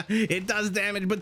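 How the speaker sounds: high laugh into the words; amused disbelief. Real, not acted. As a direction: crack up mid sentence. Unit turns on own team.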